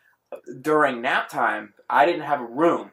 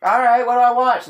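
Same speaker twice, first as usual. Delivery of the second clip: put-on high, louder child's question; kid giving in; 10 semitones higher, 6 dB louder than usual. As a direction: play a kid reluctantly asking mom. Retold child giving in to mom.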